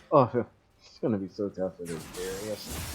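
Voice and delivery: lightly imitating a laid-back cohost with a laugh; soft and laid-back, laughing through it; chill imitation. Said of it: "how chill you are".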